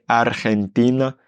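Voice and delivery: "Argentina" with a rolled r before g. Shown as a demonstration. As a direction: trill the r in "Argentina" slowly. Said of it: "il est très dur".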